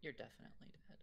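unvoiced whisper. Real, not acted. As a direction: whisper the bad news. Telling her she's dead.

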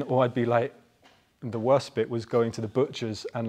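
ordinary talk

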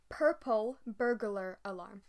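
slow, careful tongue twister; deliberately slowed purple burglar alarm; said slowly. Shown as a demonstration. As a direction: spell each word out slowly so it comes out right. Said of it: "I can say it if I say it slowly".

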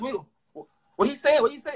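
mocking commenter. Mimics manager panicking in comments.

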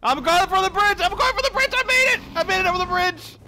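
very high, squealing shout; high-pitched triumphant panic; frantic excitement. Real, not acted. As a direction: shriek while dashing for it. Races across the bridge in game.

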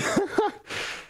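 short high-pitched laugh; high giggle. Real, not acted. Streamer pretending to leave, short high giggle. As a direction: burst into a quick high giggle. Laughs after saying he's going.

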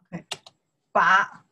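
normal talking voice

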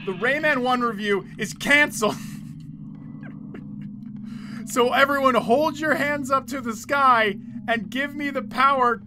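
usual speaking voice

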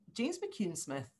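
usual speaking voice

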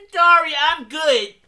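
loud, high, drawn-out mimicry; mock her refusal, slowly and loudly; exaggerated imitation of a brush-off; mocking quote.

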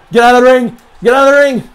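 high, loud desperate yell; shouting. Real, not acted. Player screaming as the match turns, shouting. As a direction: scream it in panic. Gamer yelling, losing match.

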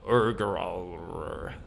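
deep rumbling dinosaur growl. Pretend. Player answering as a dinosaur, deep growl. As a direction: growl low from the chest.

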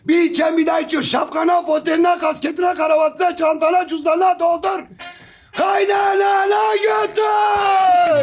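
high shouted street-vendor spiel; wild unhinged ranting. Acted. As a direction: rant like a crazed hawker. Osman's vendor-style rant.

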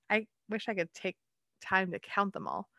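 usual speaking voice

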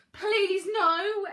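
high, loud desperate shout; exploding outburst. A narrator's reading. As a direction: burst out, begging at full pitch. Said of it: "exploded Dad".

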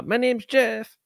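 high put-on movie voice for the quote; quoting Jeff. Quoting the 21 Jump Street line to co-host in a high character voice. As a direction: pitch up and play the movie character. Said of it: "You always bring up that Jeff comment".

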